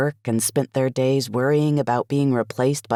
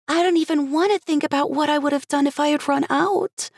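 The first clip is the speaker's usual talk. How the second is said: put-on high, bubbly young woman; Cherry's voice; 12 semitones higher than usual. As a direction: voice her bubbly and grateful.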